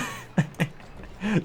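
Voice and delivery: high airy laugh; short breathy giggle. Real, not acted. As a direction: laugh at being called out. Laughing at being called out.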